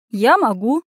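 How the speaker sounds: mild snorted boast; a touch of a cheeky snort; snorting retort. Acted. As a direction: toss it off with a snort. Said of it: "фыркнула Анюта".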